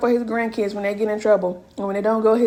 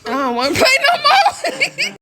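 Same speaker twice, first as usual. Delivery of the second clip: high, drawn-out mock wail; playful whining; 13 semitones higher, 4 dB louder, slower than usual. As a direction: whine it loud and high, stretching the words. Mock refusal in playful banter.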